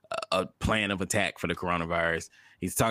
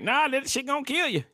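high-pitched mocking naysayer; exaggerated high-voiced alarm; mock warning. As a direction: warn them off in a high, put-on voice. Mimics warnings against the drug.

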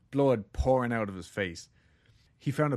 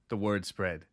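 ordinary talk

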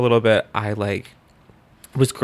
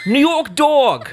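high, loud, slow New York attempt; laughing, overdone New York drawl; bad New York accent; 13 semitones higher, 7 dB louder, slower than usual. Shown as a demonstration. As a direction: bellow a badly faked New York accent. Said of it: "So bad".

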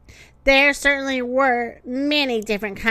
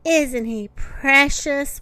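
plain reading voice

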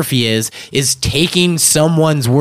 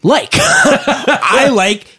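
giggling through the words; laughing; 7 semitones higher than usual. Real, not acted. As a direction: laugh while saying it. Laughing, mock liking.

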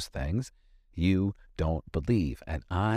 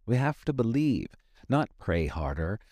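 ordinary delivery

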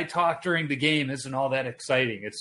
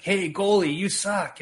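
heckling; 7 semitones higher than usual. Retold or imitated. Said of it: "be like".

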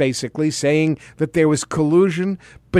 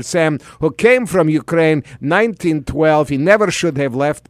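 lightly put-on Russian accent, mock Putin; a hint of Russian accent, playing Putin for laughs; Putin impression. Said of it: "Thank you, President Putin".

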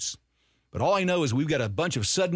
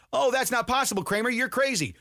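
mocking critics; 10 semitones higher than usual. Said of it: "mocking critics' voice".

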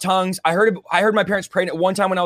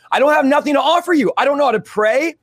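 much higher voice, helpless protest to God; pleading protest; 8 semitones higher, 3 dB louder than usual. Retold or imitated. Overwhelmed atheist answering God at the altar, pleading protest. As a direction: protest in a raised, urgent pitch.

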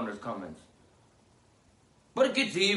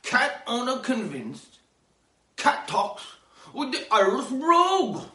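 high, louder slow headline read; headline announcer; 8 semitones higher, 5 dB louder than usual. Acted. Announcing the cat story headline, announcer voice. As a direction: sell the headline like a newscast. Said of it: "headline read".

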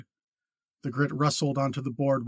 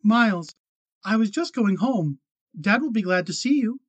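put-on high, louder nervous girl; young woman's voice; 7 semitones higher, 5 dB louder than usual. A narrator's reading. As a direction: greet him brightly, pitched up. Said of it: "Kate greeting Miles".

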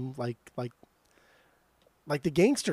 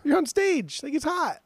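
exasperated; 12 semitones higher than usual. Real, not acted. Exasperated about hot stage outfits.

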